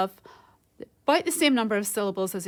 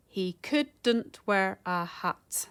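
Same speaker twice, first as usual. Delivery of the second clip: slow, separated syllables; measured syllable-counting delivery; much slower than usual. Shown as a demonstration. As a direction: count it out slowly, beat by beat.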